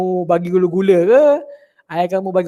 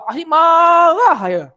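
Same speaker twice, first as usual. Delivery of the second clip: put-on high, slow angry shout at a naughty child; mock scolding mother; 8 semitones higher, 4 dB louder, slower than usual. Teacher acting out a fierce mother scolding her child, slow high shout. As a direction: play the fierce mother who is always angry.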